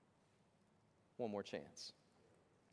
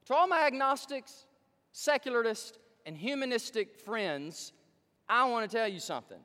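loud, higher pitched pitchman opening; radio ad voice; 5 semitones higher, 5 dB louder than usual. Retold or imitated. Preacher mimicking a godless radio commercial, loud pitchman. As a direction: hype it like a radio announcer. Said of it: "I was like".

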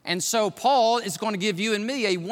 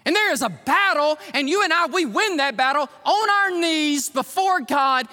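high, emphatic preacher's cadence in mounting phrases; full-force preaching; 8 semitones higher, 4 dB louder than usual. Acted. Preacher rallying church for spiritual battle, impassioned. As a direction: thunder it out, pitched high.

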